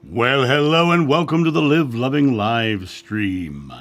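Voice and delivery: ordinary delivery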